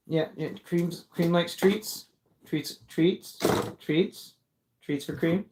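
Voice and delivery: soft, sing-song coaxing of a pet. Real, not acted. Owner offering the cat treats, soft and sing-song.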